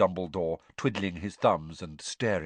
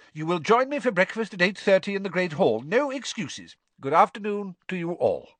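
lightly voiced calm old headmaster; a hint of gentle, wry old wizard; Dumbledore's voice; 10 semitones higher, 3 dB louder than usual.

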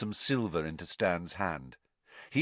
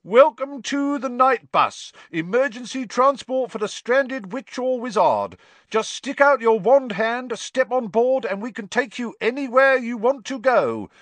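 loud announcement; 9 dB louder than usual. A narrator's reading. Bus conductor greeting a stranded wizard, loud and high. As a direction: call it out grandly into the night. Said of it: "began to speak loudly to the night".

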